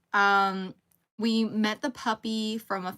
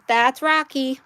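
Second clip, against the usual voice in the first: normal talking voice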